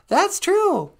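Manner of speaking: very high, bright little puppet voice; high cartoon helper voice; squeaky puppet sidekick. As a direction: pipe up as the tiny sidekick. Puppet sweetie answers host.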